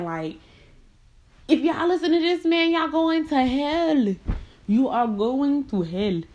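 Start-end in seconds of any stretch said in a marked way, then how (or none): 1.5-6.2 preacher warning. Retold or imitated. Warning his churchgoers, mock hellfire preaching. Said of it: "I was just thinking".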